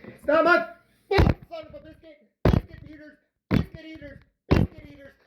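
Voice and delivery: very high, strained comic cursing; mock outburst. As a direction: squeal out silly swear substitutes. Mock rant while kneading.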